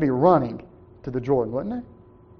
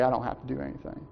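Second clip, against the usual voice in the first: much softer, mock-careless shrug of a line; dismissive; 5 semitones lower, 12 dB quieter, faster than usual. Preacher imitating people refusing baptism, softly dismissive. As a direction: mimic the indifferent excuse. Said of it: "and they say".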